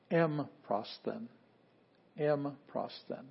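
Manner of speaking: "M prosthen" drawn out slowly, with a gap after M; slow model pronunciation. Shown as a demonstration. Teacher modelling the divided word, "M prosthen" said slowly. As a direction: say "M prosthen" slowly and evenly, then repeat. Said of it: "we can pronounce that pretty easily together".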